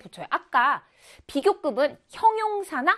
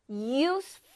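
"useful" split slowly into use, ful; syllable by syllable; much slower than usual. Shown as a demonstration. Teacher counting syllables for students, "useful" syllable by syllable. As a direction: break "useful" in two with a pause between syllables. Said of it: "이렇게 돼서 이음절 단어인데요".

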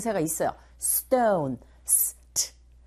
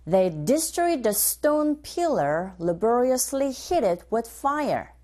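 slow, careful drill reading; teacher modelling the phrase slowly; slow model line; much slower than usual. Shown as a demonstration. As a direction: say it slowly for students to copy. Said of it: "give a shot".

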